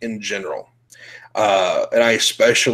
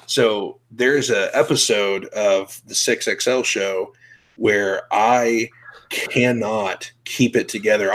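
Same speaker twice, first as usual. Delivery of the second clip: ordinary delivery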